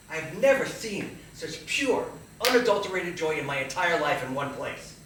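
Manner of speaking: elated wonder. Retold or imitated. Marvelling at the crowd's joy.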